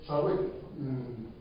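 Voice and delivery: usual speaking voice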